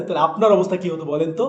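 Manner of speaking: usual speaking voice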